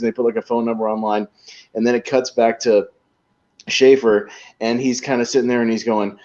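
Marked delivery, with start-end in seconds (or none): none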